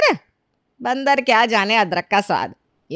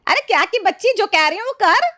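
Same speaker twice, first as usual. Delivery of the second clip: very high-pitched, cross outburst; irritated scolding; 12 semitones higher than usual. Acted. Mistress silencing a questioning maid, irritated scolding. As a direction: cut the servant off angrily.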